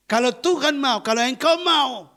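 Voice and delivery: preacher urging the congregation that nothing is impossible; preaching at full force. Acted. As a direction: proclaim the promise to the church. Preaching the key verse.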